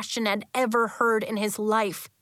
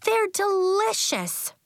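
high, slow and sweet; girl voice; 12 semitones higher, slower than usual. Acted. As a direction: savour every word.

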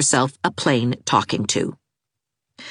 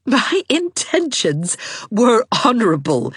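laughing; 9 semitones higher than usual. A narrator's reading. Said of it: "said Anne, laughing".

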